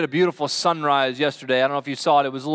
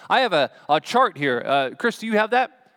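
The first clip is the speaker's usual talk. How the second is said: normal talking voice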